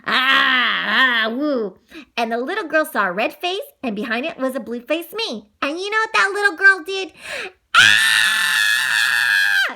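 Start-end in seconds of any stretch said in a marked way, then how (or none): 0.1-1.8 loud opening howl, then woo; ghost wail. Acted. Creature joins the boy's ghost game, wailing. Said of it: "I'm gonna join them". 7.8-9.7 long, loud scream. A narrator's reading. Little girl flees two scary faces, long scream. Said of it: "she screamed and ran off".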